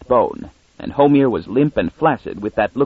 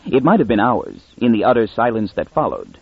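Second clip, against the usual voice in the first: usual speaking voice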